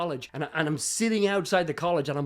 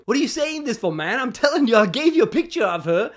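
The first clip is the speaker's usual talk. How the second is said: higher, louder annoyed comeback; irritated protest; 6 semitones higher, 7 dB louder than usual. Retold or imitated. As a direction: retell it as a heated, exasperated objection. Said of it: "I'm getting a little pissed".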